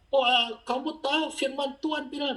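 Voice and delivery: high, eager mock-preaching; novice preacher. New convert preaches to younger sibling, excited high preaching. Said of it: "Satu jam lebih saya khotbahin".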